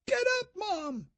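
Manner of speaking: put-on very high girl's voice, desperate; a girl shoves her mom into action; urgent young girl. A narrator's reading. Daughter begging her mother to get up, very high and desperate.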